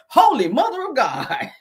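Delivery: hammed-up genteel gasp.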